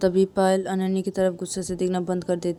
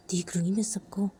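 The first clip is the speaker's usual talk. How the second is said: whispered, softer vow; whispered threat; 6 dB quieter than usual. Acted. Sister vowing revenge at dinner, whispered.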